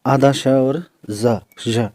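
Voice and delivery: slow, drawn-out ज between श and ज; zh sound demo. Shown as a demonstration. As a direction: blend half a श into ज slowly. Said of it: "जैसे हमने Z में बोला था ज".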